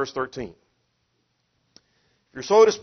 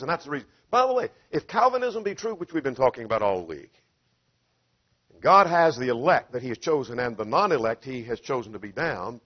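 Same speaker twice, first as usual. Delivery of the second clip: ordinary talk, nothing marked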